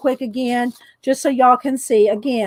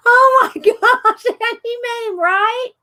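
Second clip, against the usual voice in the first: laughing; 9 semitones higher than usual. Real, not acted. Laughing high.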